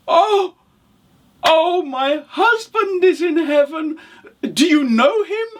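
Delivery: very high, breathless-with-wonder character voice; excited widow. Acted. Widow asking traveller about dead husband, excited. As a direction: gush it high and hopeful.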